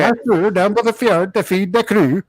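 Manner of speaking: singsong hammed-up Swedish accent. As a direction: play a jolly Swedish fisherman accent. Mock Swedish after accent talk.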